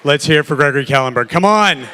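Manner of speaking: loud, fast emcee call to the crowd; loud announcer hype. Real, not acted. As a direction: pump up the room, shouting. Hyping crowd for founder's entrance.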